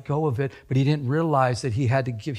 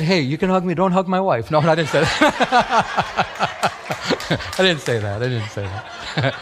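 real laughter breaking through the words; loud, laughing through it; 5 dB louder than usual. Real, not acted. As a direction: joke loudly while cracking up. Joking about hug, laughing along.

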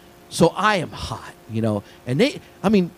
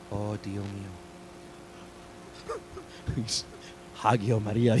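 hushed Spanish exclamation, imitated; stunned Colombian dean; 5 semitones lower, 12 dB quieter than usual. Retelling a dean walking into the wrecked bathroom, hushed shock. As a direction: softly mimic the dean's shocked prayer. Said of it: "He spoke Spanish. We walked in, he goes".

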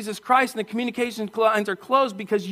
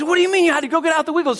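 fast, higher incredulous question; puzzled question; 7 semitones higher, much faster than usual. Retold or imitated. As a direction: rattle it off in disbelief. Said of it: "I said".

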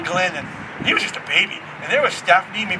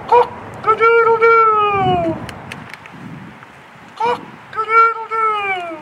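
high, drawn-out rooster crow; long, high cock-a-doodle-doo; 12 semitones higher, much slower than usual. Acted. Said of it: "Peter hears a rooster".

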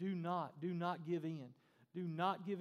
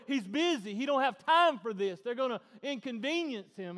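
loud, high, insistent objection; protesting disciples; 7 semitones higher, 14 dB louder than usual. Acted. Disciples keeping children from Jesus, loud protest. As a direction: shoo them off at full voice.

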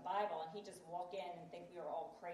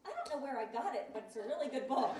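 higher, louder mock-innocent voice; imitating clueless husband; 5 semitones higher, 6 dB louder than usual. Husband praises wife's book, mock-innocent. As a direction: play innocent for the punchline. Retelling husband feigning ignorance.

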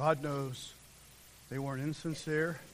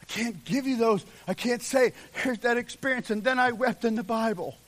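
raised, louder voice pressing the point; insistent preaching; 7 semitones higher, 8 dB louder than usual. Real, not acted. Preacher telling people he can't pass on his experience, insistent preaching. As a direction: preach it emphatically, pitched up.

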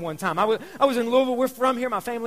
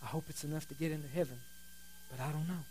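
quiet and somber, the voice sinking; somber, unsure; 8 semitones lower, 19 dB quieter, faster than usual. Retold or imitated. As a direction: let the words fall away softly in doubt. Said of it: "he got this real somber look on his face".

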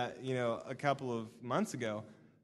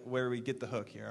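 normal talking voice

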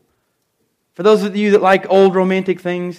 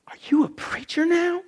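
whisper; 10 semitones higher, 8 dB quieter than usual. Retold or imitated. Repeats the lady's question.

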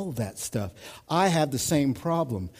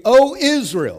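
preacher's call; 10 semitones higher, 10 dB louder than usual. Acted. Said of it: "that's church language".

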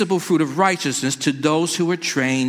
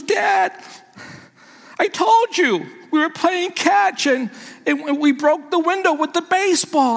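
put-on high, small voice of a teary boy; scared son; 12 semitones higher than usual. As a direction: play the frightened boy confessing.

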